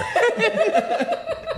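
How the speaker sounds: high, giggling laughter; can't hold back the laugh; helpless laughter. Real, not acted. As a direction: son laughing on the phone at his dad, giggling. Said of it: "I was cracking up laughing".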